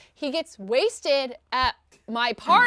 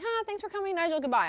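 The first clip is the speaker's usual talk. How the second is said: mock polite send-off; 6 semitones higher, faster than usual. Tenant seeing drunk landlord out, high polite goodbye. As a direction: imitate seeing off an unwanted guest in a high voice.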